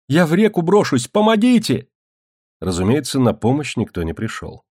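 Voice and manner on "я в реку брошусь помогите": high desperate cry for help; terrified scream. A narrator's reading. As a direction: shriek it in panic. Terrified girl cries for help.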